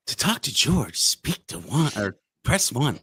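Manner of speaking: mock phone-system prompt, slowed at the end; automated phone menu. Host mocking a touch-tone phone menu, automated prompt voice. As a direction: lightly put on an automated menu voice.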